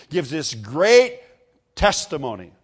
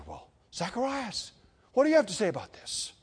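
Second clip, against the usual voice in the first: higher-pitched mock appeal; appealing relatives; 6 semitones higher, 4 dB quieter than usual. Relatives ask mute Zacharias about the name, higher and querying. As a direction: put the question in a lifted, acted voice.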